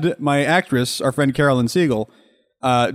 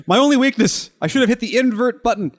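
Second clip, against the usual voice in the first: mock dramatic lament; 9 semitones higher than usual. Joking self-mocking dramatic lament.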